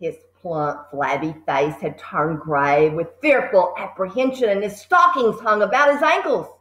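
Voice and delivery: usual speaking voice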